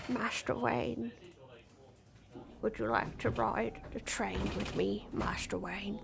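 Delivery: low, soft English butler; very low, quiet British manservant voice; Michael Caine. Acted. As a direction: address your master in a hushed, low actor impression. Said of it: "my Michael Caine impression".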